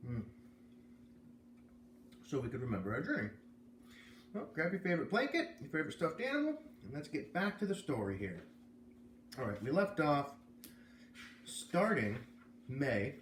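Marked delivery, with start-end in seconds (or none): 4.3-8.5 high, sing-song invitation to cuddle up; bedtime story host. Acted. Storyteller inviting children to snuggle up, sing-song. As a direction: coax the kids into story time.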